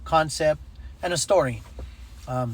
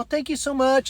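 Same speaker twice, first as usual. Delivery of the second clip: high-pitched gushing thanks; grateful woman, imitated; 9 semitones higher than usual. Grateful woman voiced in retelling.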